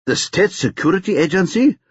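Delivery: high, incredulous question; disbelief. A narrator's reading. Colleague questions the agency's arrival, in disbelief. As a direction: spell it out, unable to believe it. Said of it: "asked Mbali in disbelief".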